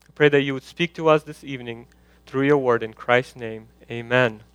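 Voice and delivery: hushed, falling prayer voice. Acted. Pastor praying over the congregation before preaching, hushed prayer.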